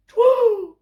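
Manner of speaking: very high, loud toot; owl toot. Acted. Albert the owl tooting alone. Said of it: "he tooted".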